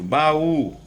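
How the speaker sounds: baú with the final ú pushed high and loud; stress on final U. Shown as a demonstration. Teacher giving an example of hiatus, baú stressed on ú. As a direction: lean hard on the ú of baú. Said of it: "U, olha, acentuado".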